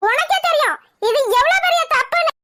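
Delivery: high-pitched, angry reproach; indignant scolding. Acted. Elder sister reproaching girl's affair, indignant scolding. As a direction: scold her, voice climbing high. Scolds girl for grave wrong.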